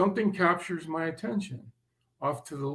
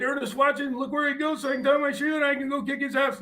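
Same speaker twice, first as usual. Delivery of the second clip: high, fast urgent plea to Ernest; high, fast, urgent retold call; 11 semitones higher, faster than usual. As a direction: hurry him along, desperate. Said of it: "I said".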